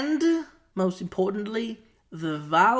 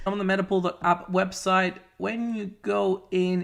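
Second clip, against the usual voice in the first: ordinary delivery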